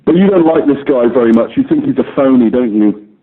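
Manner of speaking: ordinary delivery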